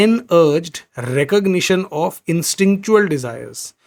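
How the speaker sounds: very slow, each word set apart; slow simplified reading. Shown as a demonstration. Teacher reads the simplified sentence to the class. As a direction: read the shorter version slowly. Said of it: "इसको थोड़ा आसान कर दूं".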